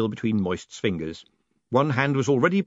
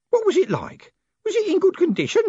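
put-on high, loud old postman; Grote insisting; 5 dB louder than usual. A narrator's reading. Old postman pressing for details about the letter, insistent. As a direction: press the question, pitched right up. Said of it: "Grote insisted".

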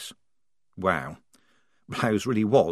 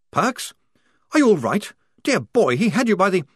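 concerned lieutenant; 10 semitones higher, 5 dB louder than usual. Acted. Blouse wakes, concerned.

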